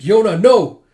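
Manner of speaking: high, very loud cry; desperate protest. Acted. Yun begs Yona not to, desperate shout. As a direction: shout to stop a friend.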